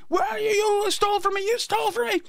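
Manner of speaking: very high imitation of furious waiter; mock angry yelling. Waiter yelling through Chinatown for a hidden thief, high mock fury. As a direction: call out in a high, strained mock yell. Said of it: "this guy yelling in Chinatown".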